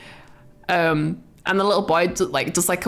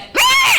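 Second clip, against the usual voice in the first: piercing high, loud bird-like screech; Hawk Girl screech; 7 dB louder than usual. Retold or imitated. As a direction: screech out one short shrill cry. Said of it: "Hawk Girl did a screech".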